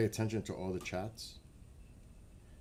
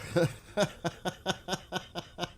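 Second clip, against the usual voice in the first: giggle; 8 semitones higher than usual. Real, not acted. Laughing again mid-question.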